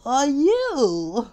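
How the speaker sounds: high, loud rising exclamation; exclaimed question. Acted. Mister Fussy confronts a stranger, high exclaimed question. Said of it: "exclaimed Mister Fussy".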